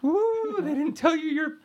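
very high spooky ghost voice. Pretend. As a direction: wail it like a cartoon ghost. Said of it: "the house makes a ghost noise and goes".

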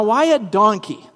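normal talking voice

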